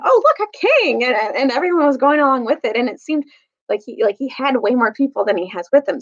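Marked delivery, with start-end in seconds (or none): 0.1-1.0 high, bright mock cry of wonder; excited exclamation. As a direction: townsfolk suddenly hailing a king, voiced with excited surprise. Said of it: "started saying".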